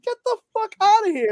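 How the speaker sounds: high-pitched mock astonishment; retold disbelief. Retelling finding out about the actress's uncle, high incredulous exclamation. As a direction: relive the discovery in a high, incredulous voice.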